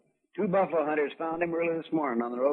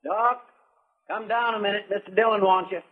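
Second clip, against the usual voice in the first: calling out; 7 semitones higher, 4 dB louder than usual. Acted. Calling Doc from downstairs.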